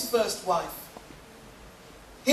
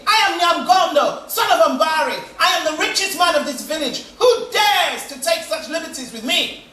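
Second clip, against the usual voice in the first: put-on drunken bellowing; 5 semitones higher, 5 dB louder than usual. Drunk husband locked out roars at the door. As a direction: bellow like a drunk lord shut out.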